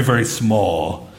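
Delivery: a hint of a grovelling worshipper; mock humble prayer. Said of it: "an old Monty Python skit".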